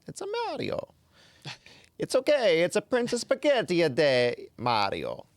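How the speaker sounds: bouncy cartoon Italian plumber accent, loud; Mario accent. Shown as a demonstration. Host reading a viewer's joke in a high, loud Mario accent. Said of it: "I'd have to say that in the Mario accent".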